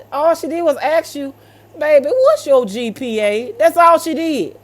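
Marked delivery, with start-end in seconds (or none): none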